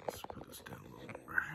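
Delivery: soft, fast whispered aside. Real, not acted. Reader whispers to herself, adjusting the book. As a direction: mutter it under your breath while fixing the book.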